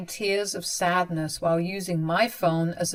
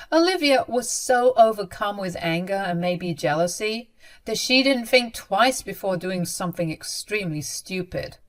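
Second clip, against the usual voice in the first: ordinary delivery, nothing marked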